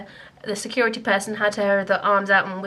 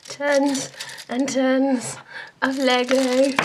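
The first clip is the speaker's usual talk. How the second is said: slow, dreamy, higher gush; 4 semitones higher, much slower than usual. Real, not acted. Confessing her Lego love, drawn-out gush. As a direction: savour each word like a treat.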